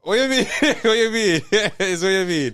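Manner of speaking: high, loud Jamaican patois through laughter; laughing patois protest. Acted. Host answering his critics, laughing high-pitched protest. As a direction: protest incredulously, cracking up.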